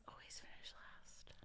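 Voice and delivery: whisper. Real, not acted. Rueful punchline.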